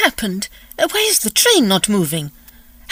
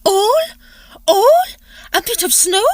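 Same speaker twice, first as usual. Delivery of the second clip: aghast; 8 semitones higher than usual. Acted. Told only snow, she is aghast.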